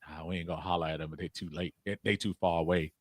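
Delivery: low, very soft retold murmur; imitating talk among ourselves. Fan retells deciding the player is too far away, hushed. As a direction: drop right down, as if keeping it between friends. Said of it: "we say to ourselves".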